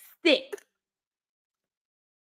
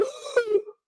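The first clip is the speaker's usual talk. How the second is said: gasp; 13 semitones higher, 4 dB quieter than usual. Retold or imitated. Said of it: "I went".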